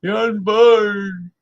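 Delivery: high, slow, drawn-out mock preacher cry; drawn-out mock wail of the unborn; mocking old zealot. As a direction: ham up the pious old extremist. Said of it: "he used to say".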